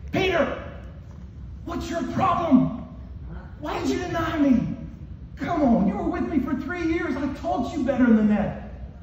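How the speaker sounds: high-pitched, exasperated telling-off; mock scolding yell. Jesus imagined confronting Peter over denying him, scolding. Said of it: "scream and yell at them".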